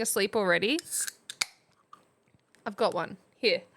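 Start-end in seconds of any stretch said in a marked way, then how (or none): none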